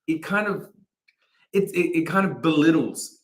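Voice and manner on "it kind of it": normal talking voice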